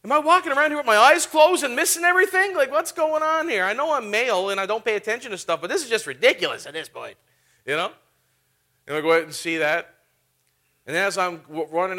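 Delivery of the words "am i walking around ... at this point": high, loud disbelieving cry; astonished outburst. Retold or imitated. Pastor retelling his shocked reaction to the vandalism, loud and high. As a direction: erupt in mock amazement at the news. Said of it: "I was like".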